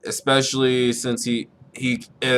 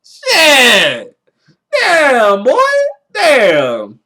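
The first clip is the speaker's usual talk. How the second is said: far higher and louder, a mock crying yell; hammed-up anguished shout; 8 dB louder, slower than usual. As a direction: wail it like the manga just broke your heart. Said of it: "I'm like".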